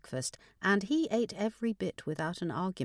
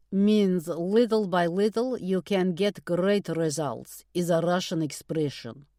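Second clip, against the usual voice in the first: put-on Russian accent; 6 dB louder than usual. A narrator's reading. Russian Nina explains.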